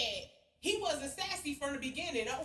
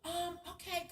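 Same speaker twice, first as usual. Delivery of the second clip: mock submissive; 5 semitones higher, 3 dB quieter, slower than usual. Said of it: "he went submissive after that".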